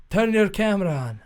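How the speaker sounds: put-on loud Russian-accented order. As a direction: order it as a pushy Russian doctor. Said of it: "like, Russian accent".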